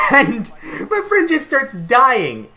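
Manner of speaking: can't hold back the laugh as you tell it. Real, not acted. Laughing while retelling.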